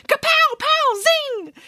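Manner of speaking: high zappy spell noises; cartoon blasts of magic; sound effects. A narrator's reading.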